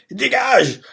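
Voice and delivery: high-pitched acted outburst; sudden high mock yell; angry henchman. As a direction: throw it out as an irate dismissal. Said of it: "énervés envers Shin".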